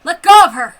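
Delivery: shouted demand. Acted. Woman yelling at the soldier holding her, loud shout.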